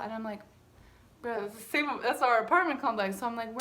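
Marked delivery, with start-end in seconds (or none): none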